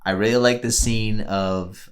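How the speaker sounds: ordinary talk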